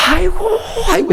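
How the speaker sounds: high, exasperated groaning; groaning father.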